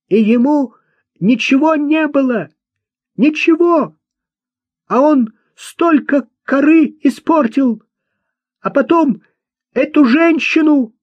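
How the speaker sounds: very high, loud indignant cries; agitated outburst. A narrator's reading. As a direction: keep protesting at the top of the voice. Климана пытаются успокоить, он кричит. (возмущённо кричать на высокой ноте)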